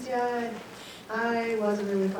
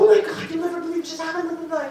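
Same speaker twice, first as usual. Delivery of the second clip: high, loud, fast gush; re-enacted frantic excitement; breathless excited report; 8 semitones higher, 6 dB louder, faster than usual. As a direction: blurt out huge news in a rush. Tears in to tell her husband.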